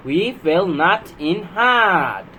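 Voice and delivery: very slow, word by word; slow word-by-word read. Shown as a demonstration. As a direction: read it out slowly for beginners. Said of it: "slow sentence reading demo".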